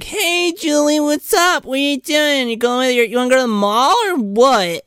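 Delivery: put-on high, bright-eyed valley teen chatter; airhead teen friends. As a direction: gush like a clueless mall-rat friend. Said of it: "they're like".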